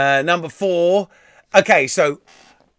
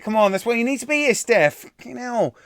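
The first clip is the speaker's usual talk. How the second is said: ordinary talk, nothing marked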